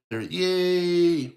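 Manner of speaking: a high, loud little cheer of joy. Acted. Basil cheering over the clue, loud and high. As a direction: cheer it like a thrilled detective.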